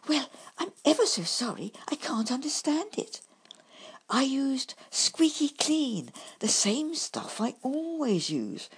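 high, hurried little apology; apologetic badger. A narrator's reading. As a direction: fluster over the mishap. Bumble apologises.

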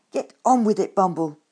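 loud, exasperated order; impatient demand. Acted. Grumpkin demands the badger get to the point, loud exasperated order. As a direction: lose patience, bark it.

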